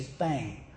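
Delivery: THANG with a Southern "ang" vowel, said softly; Southern pronunciation. Retold or imitated. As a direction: quote "thang" quietly, rhyming with "hang". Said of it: "how you say thang".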